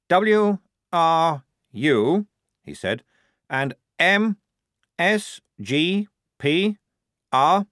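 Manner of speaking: slow, letter by letter; spelling out letters. Acted. Lieutenant reading the reply letter by letter, slowly. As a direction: read each letter off the code book.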